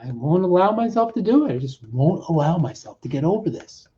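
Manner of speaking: normal talking voice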